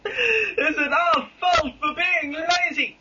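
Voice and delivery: high, slow hammed-up crying whine; sad voice. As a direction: fake sob on every word. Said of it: "I think I'll use my sad voice".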